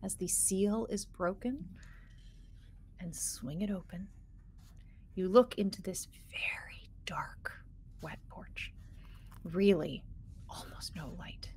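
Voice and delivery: hushed, suspenseful narration falling into whisper; game master scene-setting. Acted. Game master revealing a dark wet porch, hushed. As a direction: let the words sink softly, with pauses.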